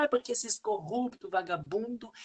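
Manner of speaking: imitando um acusador, tom bem agudo; acusador indignado. Retold or imitated. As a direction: xingamento indignado encenado, voz subindo. Imita alguém xingando os políticos. (imitando indignação, em tom agudo)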